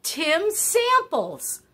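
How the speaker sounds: high, loud eureka exclamation, slowed on the name; sudden recall. Real, not acted. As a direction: shout the name as it finally comes back. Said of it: "I'll try to figure out".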